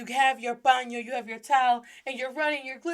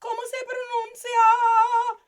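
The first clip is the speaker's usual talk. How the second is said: much higher, panicked confused student; mock panic; 12 semitones higher, 5 dB louder than usual. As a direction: squeal in mock alarm. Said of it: "and you're like".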